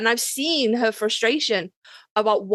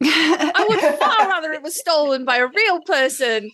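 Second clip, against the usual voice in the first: laughing through the words, a bit higher and slow; half-joking laughing plea; 6 semitones higher, 4 dB louder than usual. Real, not acted. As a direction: let the laugh spill into the plea. Joking plea about AI theft.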